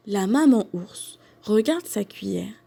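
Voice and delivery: ordinary delivery, nothing marked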